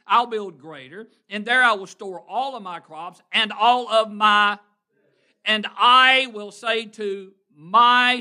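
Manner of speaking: loud rising preacher's reading of the boast, slowing on I will say to my soul; preaching at full force. Acted. Preacher acting the boastful rich man to his flock. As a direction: proclaim it big to the church.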